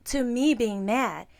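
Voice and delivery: ordinary talk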